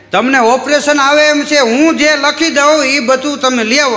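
high, loud doctor's orders; bossy doctor. Retold or imitated. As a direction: lay down the list firmly. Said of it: "આ doctor એક દર્દીને કે". (દર્દીને હુકમ કરતો ડૉક્ટર, ઊંચા અવાજે)

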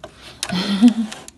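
helpless burst of laughter; laughing. Real, not acted. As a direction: crack up at the sister. Sister laughing, she cracks up.

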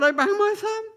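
put-on high, hurt child's complaint; a small boy reporting his injury; little boy's voice. Boy tells operator his thumb is hurt, high childlike voice.

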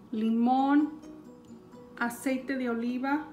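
usual speaking voice

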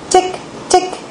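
small high-pitched tick-tick; crisp little high ticks, evenly spaced; ticking sound. A narrator's reading. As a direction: tick like a tiny hammer tapping. Imitates hammer ticking sound.

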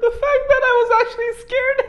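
very high, squealing laugh-talk; hysterical laughter. Real, not acted. Host admitting he was scared, laughing hysterically. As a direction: squeeze the words out through big laughs.

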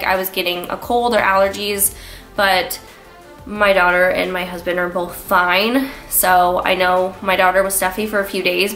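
ordinary talk

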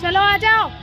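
high, loud beckoning call. Real, not acted. Vlogger calling family along at the fair, calling out.

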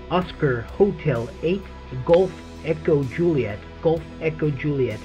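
ordinary talk, nothing marked

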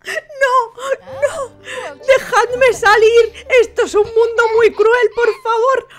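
high wailing mock baby cry, laughing through it. Sims player voices the locked-in baby, loud mock wail. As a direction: wail like a trapped baby, hammed up. Voicing baby crying in crib.

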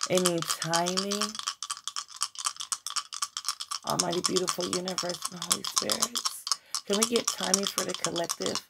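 soft, hushed prayer to the universe; a quiet earnest plea to the divine; invocation prayer. Acted. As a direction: call on spirit quietly and reverently. Prays to spirit for timing.

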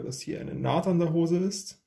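usual speaking voice

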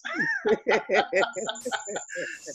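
real amused laughter after a joke; hearty laugh. Real, not acted. Host jokes about mama with dollars.

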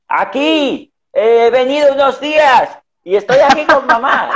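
el hermano hablando muy alto y agudo, como a una sorda; imitating loud voice. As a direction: grita como si el otro no oyera. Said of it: "Y me responde". (hablando a gritos como con la madre sorda)

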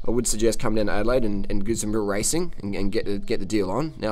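ordinary delivery

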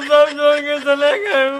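a friend left behind, hamming up his sad wail; mock crying lament. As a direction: wail it like an abandoned friend, hammed up. Abandoned friend lamenting, told don't cry.

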